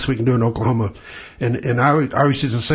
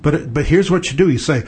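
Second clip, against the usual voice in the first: ordinary talk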